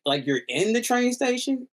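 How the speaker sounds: high incredulous question; disbelieving. Retold or imitated. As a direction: she can't believe it. Voicing the friend, disbelieving.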